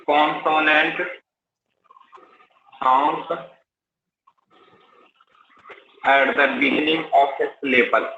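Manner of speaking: slow word-by-word dictation for notes. Acted. Teacher dictating a definition to the class, slow and deliberate. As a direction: spell it out slowly so students can write.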